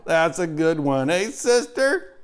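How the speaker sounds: loud, high, slow ribbing; brash chuckle. A narrator's reading. Uncle ribs Charlotte loudly about his joke. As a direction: nudge her with a loud brash tease. Pig laughing at own joke.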